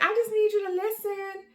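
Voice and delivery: high, loud retold tearful plea; upset, high-pitched venting; crying. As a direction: re-enact pleading to be heard through tears. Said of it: "I was crying bad".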